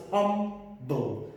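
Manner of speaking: "humble" in two beats, hum, then ble; split into syllables. Shown as a demonstration. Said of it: "are two syllables".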